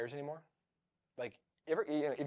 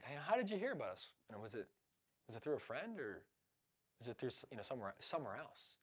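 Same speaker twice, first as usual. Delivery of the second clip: soft curious question; 4 semitones lower, 14 dB quieter than usual. Shown as a demonstration. Demo question to a caller.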